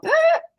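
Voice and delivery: a sudden high gasp for breath; mock heart-attack gasp; sharp high gasp. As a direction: gasp like the heart just stopped. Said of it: "they go".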